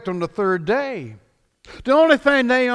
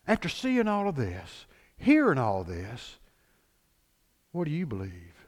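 soft Jesus voice; 6 semitones lower, 6 dB quieter than usual. Acted. Jesus voiced, quiet intimate.

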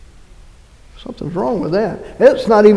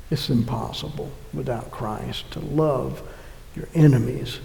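hushed earnest aside; 5 semitones lower, 10 dB quieter than usual. Real, not acted.

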